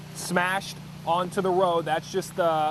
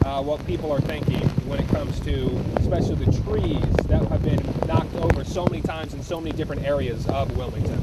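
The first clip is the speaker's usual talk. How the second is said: ordinary talk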